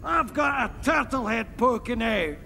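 broad Scottish brogue, crude and cheerful; thick Scottish accent, pleased with himself; Fat Bastard's Scottish. Acted. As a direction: play it as a coarse Scottish lout. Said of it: "Fat bastard".

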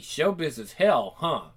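plain reading voice

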